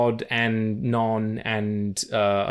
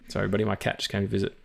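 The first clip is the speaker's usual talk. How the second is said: ordinary talk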